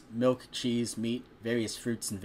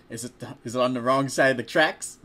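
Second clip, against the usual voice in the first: louder joking aside, laughing through it; 2 semitones higher, 8 dB louder than usual. Real, not acted. Reader breaking off to joke with the listener, laughing. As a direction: crack yourself up mid-story.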